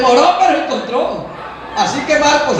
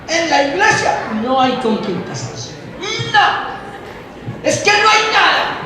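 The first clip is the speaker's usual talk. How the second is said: mock girl's complaint; 4 semitones higher than usual. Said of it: "las muchachas comienzan".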